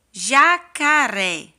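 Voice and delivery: jacaré drawn out slowly and loudly; teacher calling out jacaré slowly; slow loud model word. Shown as a demonstration. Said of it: "vamos ler com tia cada palavrinha?".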